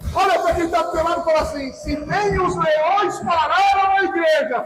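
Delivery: drawn-out, chant-like proclamation building in force; declamatory preaching. Real, not acted. Preacher declaring that nothing stopped the church, drawn-out proclamation. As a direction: stretch the phrases out, rising to a ringing call. Preaching over music to congregation.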